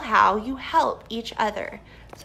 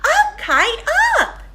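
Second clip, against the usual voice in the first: high, loud call to the kite; shout; 9 dB louder than usual. Acted. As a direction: cheer the kite upward. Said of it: "Jump up and down and shout".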